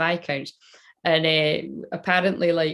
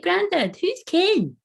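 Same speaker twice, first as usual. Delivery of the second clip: put-on very high child's question; imitated wee child; 12 semitones higher, slower than usual. Wee grandchild puzzled by granddad's Scots, imitated child voice. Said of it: "when I was wee, I used to be like".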